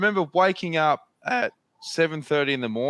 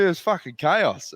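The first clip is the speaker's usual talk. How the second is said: ordinary delivery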